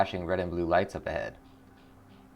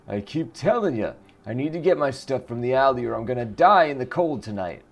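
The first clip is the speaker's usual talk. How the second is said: higher, louder desperate plea; pleading homeless man; 5 semitones higher, 5 dB louder than usual. Acted. Homeless man begs the officer for his stuff, higher pleading. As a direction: beg the cop to let him in.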